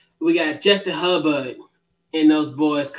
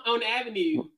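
Baltimore accent; 4 semitones higher, 6 dB quieter than usual. Shown as a demonstration. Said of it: "am I hitting that Baltimore accent?".

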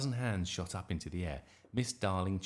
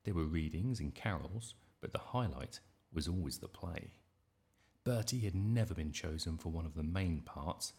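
ordinary delivery, nothing marked

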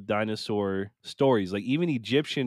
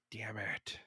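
much softer, resigned mutter; muttered curse; 15 dB quieter than usual. Retold or imitated. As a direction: sigh it out under your breath. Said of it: "I'm just like".

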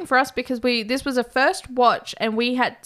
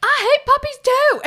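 high, loud imitated yell; mock outburst; 6 dB louder than usual. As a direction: blurt it out in over-acted fury. Imitating character yelling before ripping head off.

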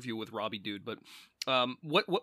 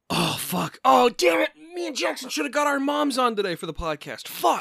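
sudden frustrated realization, pitch up high; dismayed outburst; 11 semitones higher, 8 dB louder than usual. Real, not acted. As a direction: blurt out the mistake in dismay. Realizes missed Mother's Day chance.

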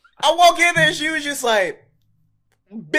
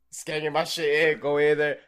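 ordinary delivery

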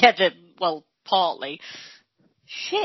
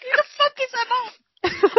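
incredulous; 13 semitones higher than usual. Retold or imitated. Relatives baffled at party.

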